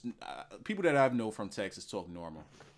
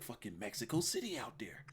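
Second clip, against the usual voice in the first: whispered aside; 3 semitones lower, 11 dB quieter than usual. Real, not acted. Aside about San Antonio trip.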